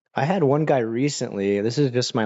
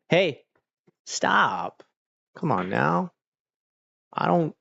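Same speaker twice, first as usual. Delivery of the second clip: scolding the dog; 5 semitones higher than usual. Real, not acted.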